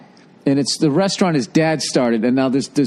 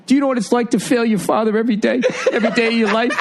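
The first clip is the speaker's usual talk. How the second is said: high, fast mock-anguished plea; imitating anguished outburst; 7 semitones higher, much faster than usual. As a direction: mimic a big guy on the verge of tears. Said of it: "his face gets all twisted up".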